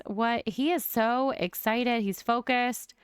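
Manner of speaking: ordinary delivery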